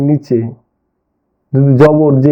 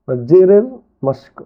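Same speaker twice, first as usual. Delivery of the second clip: normal talking voice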